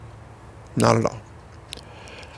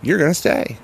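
raised, higher, louder insistence; firm retold order; 7 semitones higher, 4 dB louder than usual. Rehab staff telling her she is staying, firm and raised. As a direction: lay down the law, no arguing. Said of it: "they said".

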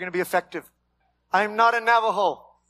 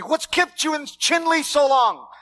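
higher, louder quoted question; mock incredulous question; 8 semitones higher, 4 dB louder than usual. Pastor voicing people who asked why he stays, loud. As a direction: call it out as people asked him. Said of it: "People have asked me".

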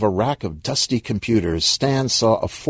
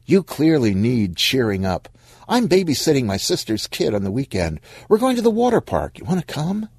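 chirpy invitation, climbing higher; lightly voiced breezy friend; 6 semitones higher than usual. Monique invites Stan to the water park, lightly breezy. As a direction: lightly play a friend coaxing someone out.

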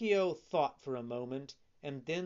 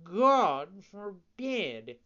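very slow, higher drawn-out cat echo; 8 semitones higher, 10 dB louder, much slower than usual. Acted. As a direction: stretch it out like a lazy cat. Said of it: "repeated the cat".

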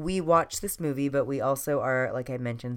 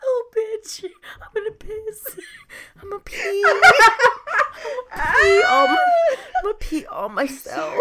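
high, squealing through helpless laughter; cry-laughing, can barely breathe; laughing hysterically; 8 dB louder than usual. Real, not acted. Laughing hard, about to wet herself.

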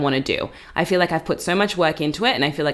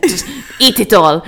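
put-on Russian accent; 4 dB louder than usual. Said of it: "in her strong Russian accent".

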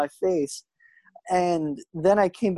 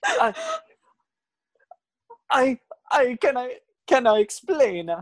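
higher, stammering plea; panicked stammer; 6 semitones higher than usual. Acted. Fernando begs Luigi for a chance to explain. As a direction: scramble for an excuse.

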